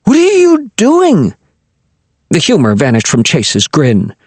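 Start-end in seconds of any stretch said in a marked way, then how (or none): 0.0-1.4 high, slow, startled woman's demand. Acted. Chase catching Viola with her knife, demanding high and slow. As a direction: catch the intruder off guard.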